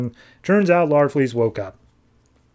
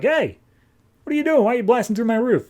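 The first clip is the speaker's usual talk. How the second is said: high-pitched, quick protest; mock startled indignation; 9 semitones higher, faster than usual. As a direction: act affronted, voice jumping up. Homeowner confronts roof crasher.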